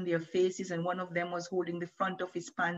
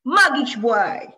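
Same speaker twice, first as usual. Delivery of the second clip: loud, high, slow drawn-out taunt; jeering yell; 6 semitones higher, 12 dB louder, slower than usual. A narrator's reading. Boy yelling a nickname at Moshe, loud and slow. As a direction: stretch the insult out in a shout. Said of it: "The other screamed with laughter".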